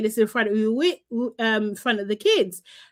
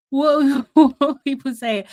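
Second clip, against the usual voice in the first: laughing; 3 semitones higher, 4 dB louder than usual. Real, not acted. Laughs while asking.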